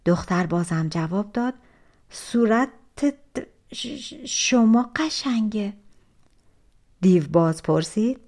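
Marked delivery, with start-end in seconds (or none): 2.1-5.7 scared stammer. Acted. Scared girl answers the giant.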